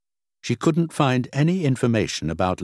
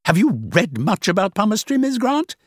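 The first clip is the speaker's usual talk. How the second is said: sharply; 13 semitones higher, 5 dB louder than usual. Acted. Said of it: "said the woman sharply".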